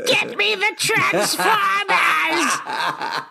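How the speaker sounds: high, slow supervillain shout; megalomaniac rant. Acted. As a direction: bellow it like a cartoon villain demanding loot. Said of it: "You sound like fucking supervillain when you do that".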